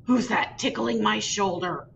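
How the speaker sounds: lightly voiced grumbling bull; a hint of a sleepy, annoyed bull; smoldering bull. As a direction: bull waking up, mildly irritated. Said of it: "the big bull smoldered".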